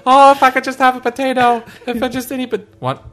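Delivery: hammed-up starving peasant; starving Irishman. Retelling the Doctor Katz bit, a dying famine victim whining. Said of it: "the guy was like".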